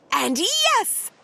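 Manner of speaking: high, bright reveal, slowing; high and slow, triumphant. A narrator's reading. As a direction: announce the find with a lift.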